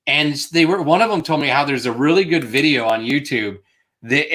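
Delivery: ordinary talk, nothing marked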